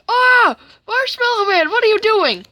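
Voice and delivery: very high, loud cartoon yell; hammed-up alarmed shouting; shocked cry. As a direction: yelp and protest as if bitten. Jack reacts to being bitten.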